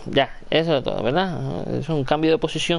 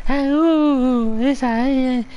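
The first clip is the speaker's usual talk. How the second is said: balbuceo al bebé; 10 semitones higher, slower than usual. Real, not acted. Cooing at the baby. (gorjeos agudos para el bebé)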